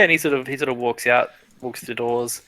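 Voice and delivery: usual speaking voice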